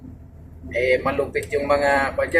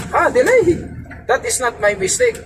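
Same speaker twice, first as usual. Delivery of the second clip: retold indignant surprise; 13 semitones higher than usual. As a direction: act shocked at being blamed. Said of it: "sabi ko".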